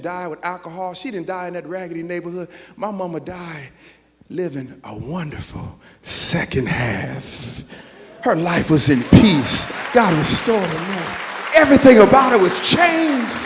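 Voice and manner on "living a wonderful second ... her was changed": slow then louder praise; preaching build. Real, not acted. Preacher building on mother's peace.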